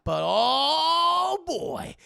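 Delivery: long, loud, rising "oh"; mock suspense. Storyteller teasing the next scare, mock-dramatic swell.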